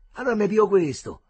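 eager grab of the best pup; quick and eager. A narrator's reading. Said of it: "fece pronto Marcello".

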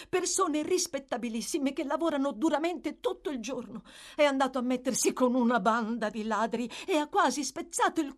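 high-pitched, dramatic complaint; emphatic. A narrator's reading. The girl tells the women of the runaway, emphatically. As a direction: plead the case with great emphasis. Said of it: "rispose con enfasi la ragazza".

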